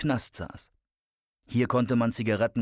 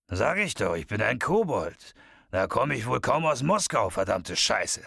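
swooping character voice, exasperated; irritated bearded leprechaun. Acted. Bearded man tells Shadow where he's from, exasperated. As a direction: insist on it with swinging pitch, then swear.

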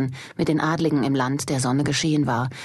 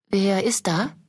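fearful call; 4 semitones higher than usual. Acted. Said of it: "rief sie ängstlich".

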